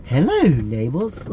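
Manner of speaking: slow, hammed-up British greeting; drawn-out mock British hello; bad British accent. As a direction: put on a terrible British accent. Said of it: "That's my worst British accent".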